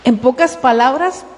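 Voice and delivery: ordinary talk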